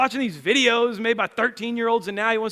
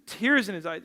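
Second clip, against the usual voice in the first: ordinary delivery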